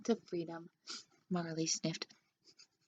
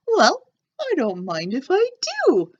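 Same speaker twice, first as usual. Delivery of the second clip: higher, louder cheeky dog voice; a greedy pup helping himself; playful dog voice accepting a treat; Marley the dog; 6 semitones higher, 8 dB louder than usual. A narrator's reading. Dog finds hot dogs.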